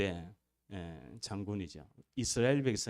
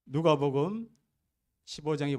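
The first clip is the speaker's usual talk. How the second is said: ringing announcement; 6 semitones higher, 7 dB louder than usual. Real, not acted. Preacher announcing scripture passage.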